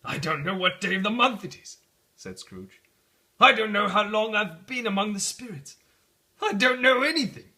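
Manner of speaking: giddy bewilderment. Acted. Scrooge babbling after the spirits leave, giddy. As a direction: blurt it out, delighted and lost.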